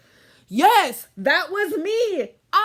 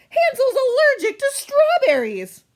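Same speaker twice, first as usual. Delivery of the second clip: high, loud panicked blurt; shrill sudden alarm over a food allergy; alarmed cry; 11 semitones higher, 6 dB louder than usual. Acted. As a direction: sound the alarm for your brother.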